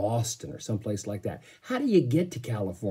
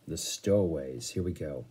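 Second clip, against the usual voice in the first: ordinary delivery, nothing marked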